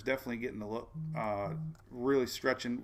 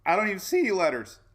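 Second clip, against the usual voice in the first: fast, higher, louder frustrated protest; exasperated outburst; 6 semitones higher, 6 dB louder, faster than usual. Retold or imitated. Squinting at the login puzzle, a fast, loud, incredulous complaint. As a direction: blurt it out in disbelief. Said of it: "you're like".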